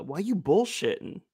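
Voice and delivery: a touch of a friend's catchphrase, slowed; drawn-out quoted catchphrase; quoting a friend. Retold or imitated. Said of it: "to use one of your quotes".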